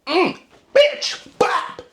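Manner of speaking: high-pitched, hammed-up irritation; mock frustrated snap. Wanting to backhand someone talking nonsense, mock frustrated snap. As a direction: lash out in a comic high voice. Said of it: "really frustrated state".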